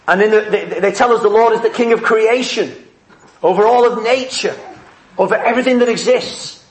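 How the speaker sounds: usual speaking voice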